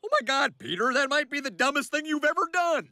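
high, outraged rebuke; aghast scolding. Acted. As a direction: berate a friend who did something idiotic. Friend scolds him after the shooting.

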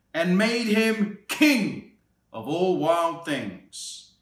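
lively picture-book read-aloud; storybook narration for a little one; reading to children. A narrator's reading. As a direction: read it like bedtime story time.

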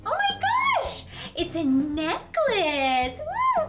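high, squealing delight; thrilled squeal. Acted. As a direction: squeal with joy. Thrilled at a necklace.

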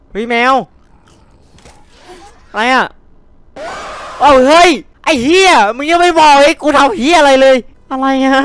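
high, loud frightened cries; panicked yelling. Real, not acted. Streamer yelling at the game as a creature attacks, panicked. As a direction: shout in real panic.

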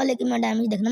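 usual speaking voice

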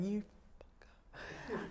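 slow whispered curse under the breath. Retold or imitated. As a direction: hiss it to yourself, unheard. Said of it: "in my head going".

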